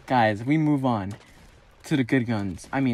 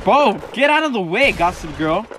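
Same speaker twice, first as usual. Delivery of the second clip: calling out; 6 semitones higher, 6 dB louder than usual. Real, not acted. Calling at teammate.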